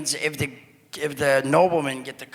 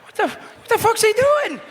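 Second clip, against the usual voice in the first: high-pitched incredulous shout; voicing a stunned onlooker; baffled outburst; 4 dB louder than usual. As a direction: blurt it out in mock disbelief. Voicing everyone's stunned reaction.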